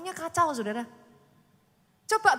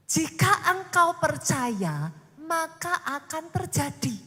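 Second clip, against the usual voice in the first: slow, emphatic proclamation; scripture proclaimed; 3 semitones higher, 5 dB louder, slower than usual. Acted. Preacher quotes scripture to congregation, slow and emphatic. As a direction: declare the verse word by word. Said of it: "Alkitab itu ngomongnya gini".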